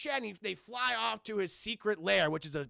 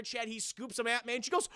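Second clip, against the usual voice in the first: ordinary talk, nothing marked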